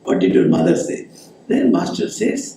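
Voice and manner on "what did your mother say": teasing, much lower mock voice; mocking repeat. Naren teasing Master, mocking in a much lower voice. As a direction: poke fun at him as you repeat it. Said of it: "he makes fun of Master".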